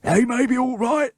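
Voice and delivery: high, loud, strained crook's voice; gasping Bert. Acted. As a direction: wheeze it out from under the pile. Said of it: "gasped Bert".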